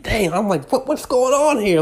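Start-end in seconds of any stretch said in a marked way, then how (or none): 0.0-0.3 a high, mock-stunned "Dang". Inner reaction to the husband's partying, high mock disbelief. 0.7-1.8 much higher, incredulous question; baffled. Retold or imitated. Puzzling over the friend's dad's behaviour, high and incredulous. His inner reaction to the husband.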